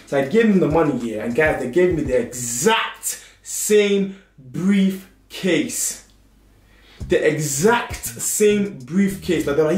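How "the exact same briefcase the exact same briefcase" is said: slow emphasis. Real, not acted. Stressing the scam reveal.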